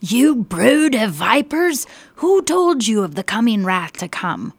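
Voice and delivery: loud, slow prophet's rebuke; a fiery, accusing shout. Acted. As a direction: thunder it at the religious leaders. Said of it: "he shouted out at them".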